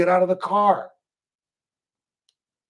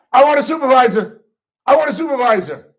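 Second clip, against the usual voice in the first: higher, louder, fast demand; demanding arrestee; 6 semitones higher, 7 dB louder, faster than usual. Retold or imitated. Arrestee yelling at the officer for a supervisor, loud and fast. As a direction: insist on the supervisor. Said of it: "Number three".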